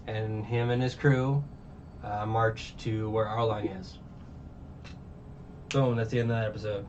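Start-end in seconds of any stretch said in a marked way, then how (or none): none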